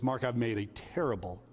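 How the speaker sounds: retold tearful. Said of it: "he was in tears".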